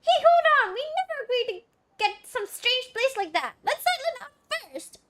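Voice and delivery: very high, loud-starting mascot outburst; squeaky protest. Acted. Companion refusing the watcher's plan, squeaky indignant voice. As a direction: object in a piercing little companion voice.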